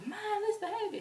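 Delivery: high-pitched fangirl call; high, acted-out fan cry. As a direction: squeal the band's name. Imitating fans chanting band name.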